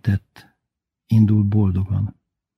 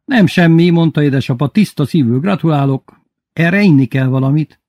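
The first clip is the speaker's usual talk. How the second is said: higher, louder hearty father; a judge congratulating his friend, louder; apa hangja; 7 semitones higher, 6 dB louder than usual. Acted. Father's lines in dialogue. (felemelt hangú, joviális apa)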